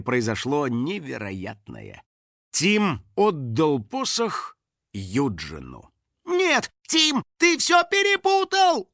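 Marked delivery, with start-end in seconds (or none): 6.3-8.9 high-pitched cry of dismay; horrified. A narrator's reading. House spirit watching the boy err, horrified. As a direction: wail high, aghast. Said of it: "ужаснулся Финик".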